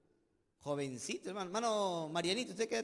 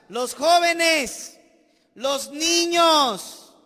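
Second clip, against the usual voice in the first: drawn-out, louder, higher emphasis on los niños; stressed slow call; 6 semitones higher, 11 dB louder, much slower than usual. Real, not acted. Pastor urging the church, slow and loud on los jóvenes. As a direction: stretch each word out to the congregation.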